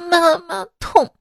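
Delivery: 学小孩委屈地说痛，带点哭腔; 轻轻模仿小孩哭着喊疼; 孩子带哭腔. Retold or imitated. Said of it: "小孩说". (模仿孩子带哭腔说痛)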